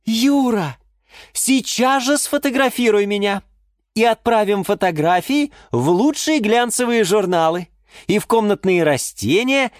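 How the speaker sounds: high-pitched eager demands; excited exclamation. A narrator's reading. Geranium demands photos for magazines, excited. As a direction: demand it all at once, excitedly. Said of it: "воскликнула герань Антонина".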